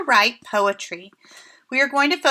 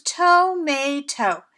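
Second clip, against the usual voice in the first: slow, each syllable of tomato stretched apart; syllables spelled out; 2 semitones higher, much slower than usual. Shown as a demonstration. Teacher counting chin taps with pupils, slow and syllable by syllable. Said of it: "You should have had three chin taps".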